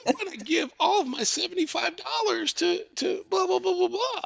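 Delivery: very high, put-on child's voice; little kid voice. Prank caller posing as a child donor, little kid voice. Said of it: "love a little kid voice".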